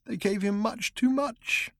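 high, slow, small whimpering voice; a broken little whimper; tiny whimper. Acted. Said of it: "he said in a tiny whimper".

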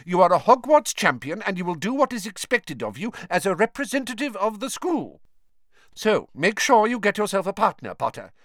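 high, commanding teacher voice; firmly. A narrator's reading. Professor ordering the school champion to find a partner, firm. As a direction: lay down his duty, allowing no argument. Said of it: "said Professor McGonagall firmly".

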